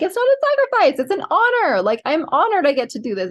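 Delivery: very high, squeaky insistence, easing down by the end; emphatic reply. Retold or imitated. Wife answering husband's thanks in a very high, emphatic voice. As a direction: protest sweetly that it's an honor.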